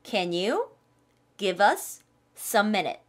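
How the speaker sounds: very slow, each word spelled out; drawn-out teaching read; slow model line. Shown as a demonstration. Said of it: "할 때 쓸 수 있는 말입니다". (아주 천천히 또박또박)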